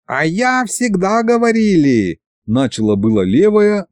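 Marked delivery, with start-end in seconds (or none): 0.1-2.2 high, slow, squeaky put-on voice; squeaky dragon head. A narrator's reading. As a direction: pipe up smugly in a high voice. Left head starts tattling.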